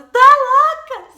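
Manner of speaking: high, loud, slow yelling at a crazy sister; mock yell. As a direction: shout it out slowly in disbelief.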